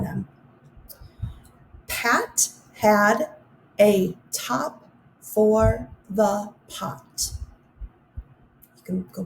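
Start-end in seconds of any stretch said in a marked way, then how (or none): 1.9-7.3 very slow, each word set apart; slow careful reading. Shown as a demonstration. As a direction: read it slowly so they can catch the ah. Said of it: "listen for the ah sound".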